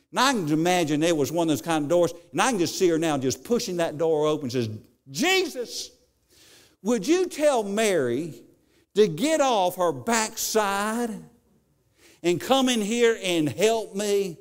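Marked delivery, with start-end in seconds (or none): 5.1-14.4 higher, slow, exasperated complaint; flustered Martha. Retold or imitated. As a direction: push the door open and demand help. Flustered Martha bursting through door.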